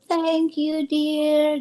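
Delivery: a hint of a shaky old grandma's voice; trembling old lady. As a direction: lightly voice the frail grandma thanking you. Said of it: "dia ngomongnya pun udah tremor". (sedikit meniru suara nenek yang gemetar)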